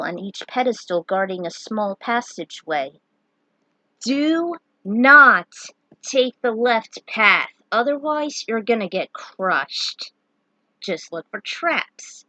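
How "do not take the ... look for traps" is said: slow, loud warning; lightly voiced gargoyle. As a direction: boom a grim warning.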